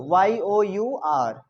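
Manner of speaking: slow letter-by-letter spelling. Shown as a demonstration. Teacher spells a word for the class, slowly letter by letter.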